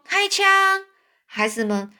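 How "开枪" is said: mock command shout, pitched much higher; retold shout. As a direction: call out the order to fire, much higher. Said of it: "我连忙大声喊说". (复述时高声喊出的命令)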